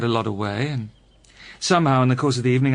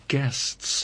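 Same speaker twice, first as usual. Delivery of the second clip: hushed insistence; 6 dB quieter than usual. Acted. Pointed repeat to the friend.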